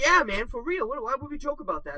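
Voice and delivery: high-pitched, insistent protest; mock indignation. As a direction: protest as if offended by the doubt. Pretending offence to sell the prank.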